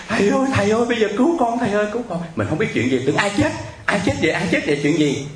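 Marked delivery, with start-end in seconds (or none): 0.1-2.2 imitating a frantic caller, high-pitched cries for help; wailing plea. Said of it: "nghe bên kia khóc gào lên". 3.1-5.3 high, quick startled questions, retold; alarmed bewilderment. Startled by a wailing midnight call.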